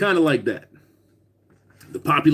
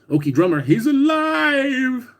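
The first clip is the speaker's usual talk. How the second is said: ordinary delivery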